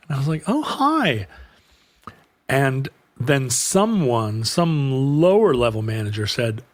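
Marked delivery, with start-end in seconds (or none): 0.5-1.3 high chirpy "Oh, hi"; awkward hi. Retold or imitated. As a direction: brighten it up high. Awkward greeting back.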